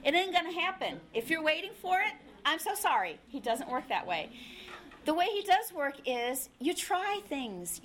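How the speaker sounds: ordinary delivery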